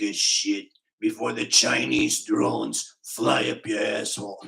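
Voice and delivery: put-on straining old man with a sandpaper rasp; Richie from Boston. Shown as a demonstration. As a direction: force it out, rasping, in a Boston accent. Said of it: "if you're gonna do a Richie voice".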